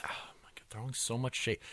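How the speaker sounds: unvoiced stage whisper. Real, not acted. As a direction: whisper it aside, teasing. Aside at co-host's dig.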